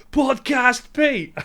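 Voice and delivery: high, drawn-out call; teasing shout. Retold or imitated. Friend calling out as he passes on a wave, teasing shout. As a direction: sing out the nickname in a long high holler. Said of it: "you were just shouting at me".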